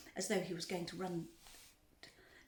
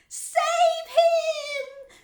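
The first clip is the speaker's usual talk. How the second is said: high, long-drawn scream; 17 dB louder than usual. A narrator's reading. Mother as her son falls in the river, screaming. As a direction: scream it at full pitch and volume. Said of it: "screamed Missus Gloop".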